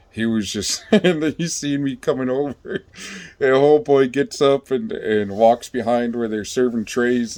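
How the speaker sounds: normal talking voice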